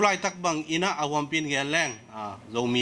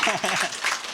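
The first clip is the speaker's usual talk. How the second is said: real laugh, higher than the speaking voice; short burst of laughter; 4 semitones higher than usual. Real, not acted. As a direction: break into a brief laugh. Laughs after joking aside.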